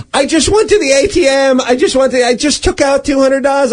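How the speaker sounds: imitated high-pitched wail of dismay; panicking, lamenting. Mugged woman laments her cash to her rescuer, imitated panic. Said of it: "She was panicking and kind of lamenting".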